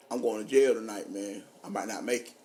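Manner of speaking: low and soft, dead serious; low gangster talk. Retold or imitated. Telling his dad he may be arrested, low and grim. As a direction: murmur it like a hard man saying goodbye. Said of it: "I'm talking like a real gangster now".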